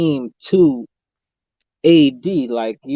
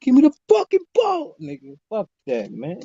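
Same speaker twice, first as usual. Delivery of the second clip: high-pitched hammed-up yell for the ball; mock screaming demand; 9 semitones higher than usual. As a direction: screech it like a player demanding the ball. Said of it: "Screaming for it".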